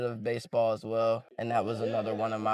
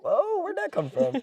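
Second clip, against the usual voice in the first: much higher startled reaction; mock surprise. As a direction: act caught off guard by mom's twang. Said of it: "and I'm like".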